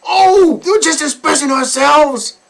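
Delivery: high, loud mock excuse; mocking the parents' excuse. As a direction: parody their defense.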